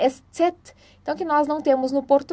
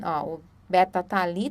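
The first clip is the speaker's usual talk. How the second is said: ordinary talk, nothing marked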